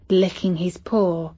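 ordinary delivery

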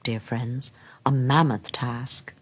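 usual speaking voice

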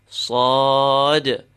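sad with the ah pulled long; extended letter sound. Shown as a demonstration. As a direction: sustain the back ah in sad. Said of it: "speaking of the extension".

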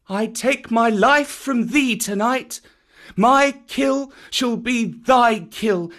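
put-on boy swearing the jungle oath; solemn boy. A narrator's reading. Mowgli speaking.

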